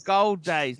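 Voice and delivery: lightly put-on London accent; Cockney accent. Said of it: "Explain it in a Cockney accent".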